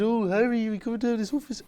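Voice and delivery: very high, silly mock teacher voice; stupid teacher voice. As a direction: squeak it out like a ridiculous teacher imitation. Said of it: "had a voice like this".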